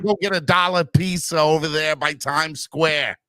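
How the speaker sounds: hammed-up New York accent; mock a New Yorker's accent with gusto. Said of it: "You're killing the accent".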